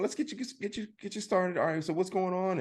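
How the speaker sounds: eager service greeter. Retold or imitated. Mimicking upscale dealership staff greeting.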